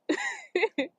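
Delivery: a sudden high-pitched laugh. Real, not acted. Remembering the movie, a high squeaky laugh.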